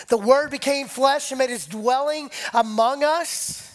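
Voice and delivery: ordinary talk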